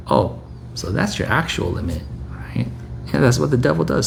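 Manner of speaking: ordinary talk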